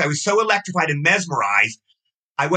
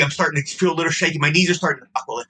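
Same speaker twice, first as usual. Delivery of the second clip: usual speaking voice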